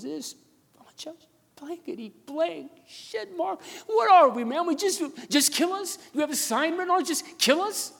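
imitating a man in tears, pitched very high; choked, high cry building to a loud plea; tearful outburst. Distraught store worker pours it out to pastor, acted tearful. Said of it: "just melted into tears".

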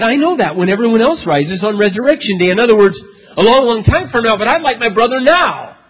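voicing Martha. Martha pleading with Jesus.

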